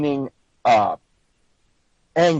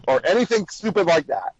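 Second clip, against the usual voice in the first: ordinary talk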